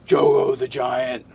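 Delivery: very slow, drawn-out giant voice; deep slow giant. Shown as a demonstration. Player trying out his character's voice, deep slow giant. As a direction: stretch each word like a lumbering giant. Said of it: "talk real deep and, and kinda slow".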